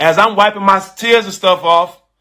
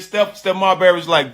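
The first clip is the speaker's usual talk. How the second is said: ordinary talk, nothing marked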